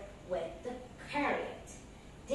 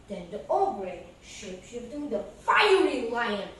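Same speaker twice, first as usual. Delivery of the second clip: usual speaking voice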